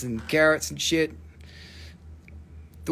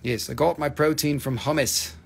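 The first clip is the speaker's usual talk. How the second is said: ordinary talk, nothing marked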